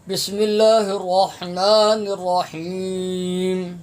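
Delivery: slow recited بسم اللہ الرحمن الرحیم; recitation. Acted. Teacher opening the reading, slow recitation. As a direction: intone it slowly before the lesson.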